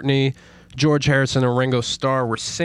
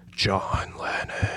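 slow, soft, unvoiced ghost whisper; ghostly voice; 4 semitones lower, 8 dB quieter, slower than usual. Host voices the ghost of [name] whispering his name. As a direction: breathe the name like a spirit. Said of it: "heard [name] saying".

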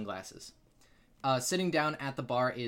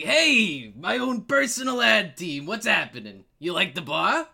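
loud, high-pitched hail from a bar owner; booming greeting; 6 semitones higher, 9 dB louder than usual. Acted. Larry greets the party as they walk in, booming greeting. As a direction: call out big and delighted to arriving regulars.

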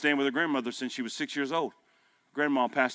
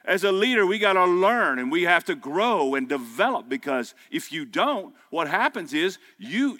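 raised, slowed preacher cadence; preaching; 6 semitones higher, 5 dB louder than usual. Acted. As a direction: drive home each number to the crowd. Preaching to the congregation.

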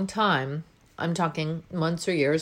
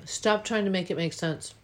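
ordinary delivery

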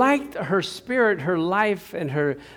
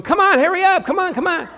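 high, urgent mock shouting; impatient hecklers; 12 semitones higher, 6 dB louder than usual. People behind you on the dive, impatient urging. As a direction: badger them in a raised, hurrying voice. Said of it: "people behind you going".

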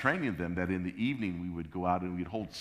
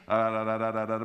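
tag babbling; 3 semitones higher, 5 dB louder than usual. Retold or imitated. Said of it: "she starts babbling".

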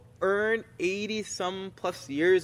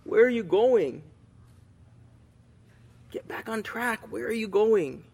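concerned urgent plea; 5 semitones higher than usual. Retold or imitated. Concerned friend shaking a lost person, urgent pleading. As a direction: plead like a worried friend grabbing your shoulders. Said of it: "like a concerned friend".

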